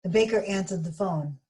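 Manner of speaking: plain reading voice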